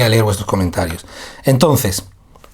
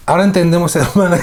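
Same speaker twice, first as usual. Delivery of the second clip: higher and louder, laughter bubbling through; laughing; 7 semitones higher, 5 dB louder, faster than usual. Real, not acted. Teacher understanding the joke, laughing at the sister. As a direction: get the words out between laughs. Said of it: "nunca me ha pasado esto".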